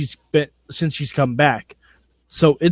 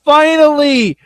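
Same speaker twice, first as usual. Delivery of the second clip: high, loud, drawn-out cry; triumphant yell; 9 semitones higher, 5 dB louder, slower than usual. Pretend. Co-host crowing about tag team champions at last, triumphant yell. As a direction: shout it high, hanging on each syllable.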